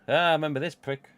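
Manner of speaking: excited recognition. Real, not acted. Unboxing on stream, he spots a familiar figure, excited. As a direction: blurt it out, delighted to remember. Recognising item, sudden outburst.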